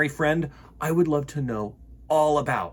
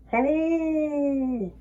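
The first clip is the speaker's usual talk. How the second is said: echoing moon; 13 semitones higher than usual. Acted. The moon echoing Bear's call, distant echo. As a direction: answer like a faint echo. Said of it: "replied the moon".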